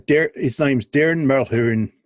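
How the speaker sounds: slow, put-on Irish accent. Shown as a demonstration. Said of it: "that was my Irish accent".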